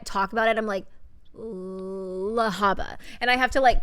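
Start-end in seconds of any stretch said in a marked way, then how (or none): 1.3-3.0 Lahaba, drawn out slowly from the L; careful self-correction. Shown as a demonstration. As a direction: stretch "Lahaba" carefully, la-HAH-ba. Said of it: "correct myself because I'm like".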